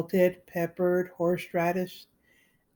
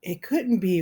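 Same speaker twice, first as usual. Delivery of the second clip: usual speaking voice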